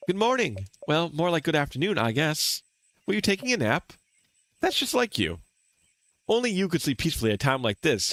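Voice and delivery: cheerful friend waking sleeper. Acted. Voices a game character.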